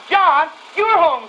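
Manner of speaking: high, loud, slow cry of joy; mother sees her son at the threshold, crying out; cries out. Retold or imitated. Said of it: "she cried out".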